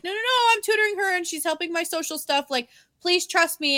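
high, hurried-in pleading; pleading protest. Retold or imitated. Grandson vouching for the girl to grandma, pleading.

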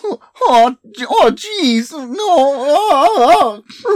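high, loud squeaky cartoon whine. Joking on cartoon characters, squeaky and loud. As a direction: yelp it like a panicked cartoon.

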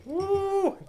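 high drawn-out ooh; sour ooh. Real, not acted. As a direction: pucker up at the sourness. Tasting sour drink.

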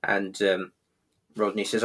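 ordinary delivery

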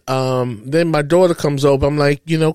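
ordinary delivery

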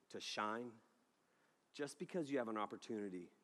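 soft, low, earnest preaching cadence; quiet preaching. Real, not acted. Preacher drives home the lesson, soft and earnest. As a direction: lay it down gently, building to the point.

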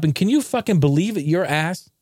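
usual speaking voice

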